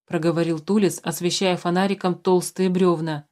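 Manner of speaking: ordinary delivery, nothing marked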